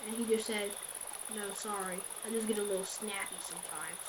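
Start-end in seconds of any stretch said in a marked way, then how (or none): 1.3-4.0 quiet apology. Retold or imitated. Caller suddenly apologising.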